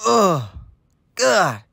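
normal talking voice

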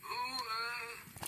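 high strained groan; engine groan. A narrator's reading. Sixteen groaning as the rails sink, high and strained. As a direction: groan as the ground gives way. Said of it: "groaned sixteen".

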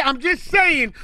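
ordinary delivery

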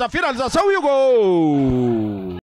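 slow drawn-out goal shout; goal call. Real, not acted. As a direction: hold the goal call long.

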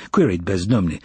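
usual speaking voice

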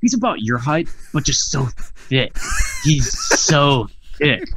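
hammed-up, over-impressed admiration; imitating gushing fan. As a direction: gush like a starstruck fan, drawing out the praise. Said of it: "fucking jizzing himself over the fact".

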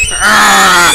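loud mock shriek under fire; playful shriek. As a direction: yelp as you're hit. Shrieking while shot.

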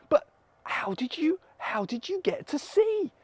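high, astonished stammer; amazed questioning. Acted. Crowd asks healed beggar about his sight, amazed.

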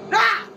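startled yelp. Real, not acted. Jump scare reaction.

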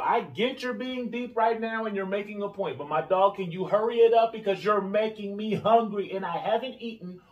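high put-on voice, dragging the end; mock impatient heckler. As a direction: heckle yourself, starving and impatient.

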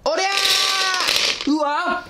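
long high battle cry; high yell. Real, not acted. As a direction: stretch the effort yell way up. Pounding the mochi.

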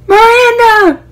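excited call. Retold or imitated. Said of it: "and she goes".

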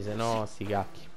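usual speaking voice

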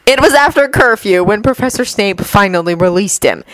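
usual speaking voice

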